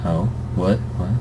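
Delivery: ordinary delivery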